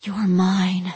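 lightly hissed claim in the ear; a touch of a hiss, staking a claim; possessive hiss. A narrator's reading. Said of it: "as she hissed".

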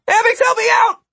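high, loud cry for help; desperate cry. A narrator's reading. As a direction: holler for help at the top of your range. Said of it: "Martin cried".

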